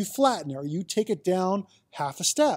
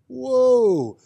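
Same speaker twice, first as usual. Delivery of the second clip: amazed whoa; 6 semitones higher, 7 dB louder than usual. Acted. Flavor brought out, amazed.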